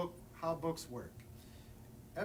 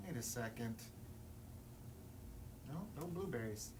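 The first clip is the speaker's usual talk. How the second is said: lower, softer protest; low grumpy objection; 6 semitones lower, 10 dB quieter than usual. Acted. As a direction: mutter your refusal.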